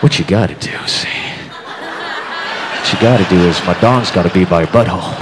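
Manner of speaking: low, smug pickup-artist croon; sleazy low Casanova coaching a date; smooth Casanova. Retold or imitated. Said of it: "the Johnny Casanova".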